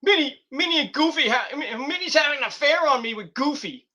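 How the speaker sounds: high, squeaky cartoon voice; whiny cartoon mouse complaining; mock Mickey Mouse. Mickey complaining to Michael Cole in a high cartoon voice. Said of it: "Mickey's like".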